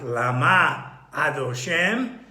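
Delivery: lama slow, with the stress on MA before adoshem; stress on second syllable. Shown as a demonstration. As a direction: land on the MA of lama. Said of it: "the emphasis on the second syllable".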